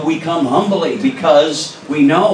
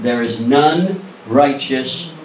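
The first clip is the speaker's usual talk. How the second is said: slow emphasis; much slower than usual. Real, not acted. Slow emphatic scripture reading.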